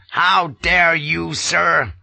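slow, loud outrage; indignant. A narrator's reading. Colonel outraged at slur on the Maharani, indignant. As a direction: bristle with offended honour.

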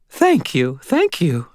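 high-pitched, heartfelt thanks; grateful landlady. A narrator's reading. Landlady releasing rescuer from hug, high and grateful. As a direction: thank him as a relieved landlady.

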